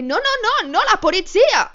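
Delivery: panicked no. Retold or imitated. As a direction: cry out in alarm, denying it. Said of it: "j'ai fait". (« non, non », crié plus fort et plus aigu, en panique)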